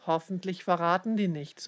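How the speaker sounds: ordinary delivery, nothing marked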